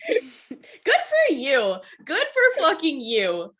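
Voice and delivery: long, dragged-out sarcastic congrats; mock drawled cheer. Real, not acted. To a co-host who admits not knowing, drawled mock cheer. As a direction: stretch each word like a mock toast.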